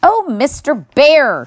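calling out to be heard; shouted call. A narrator's reading. Girl shouts up at the bear who ignores her.

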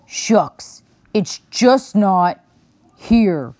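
slow, gravelly bear grumble, ready for rest; a tired old bear muttering to himself; grumbling old bear. A narrator's reading. Old bear grumbles at the door, puzzled and tired. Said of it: "he grumbled".

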